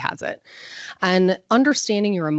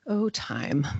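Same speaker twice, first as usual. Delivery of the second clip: whispered aside; 3 semitones lower, 7 dB quieter than usual. Real, not acted. Aside to herself on time.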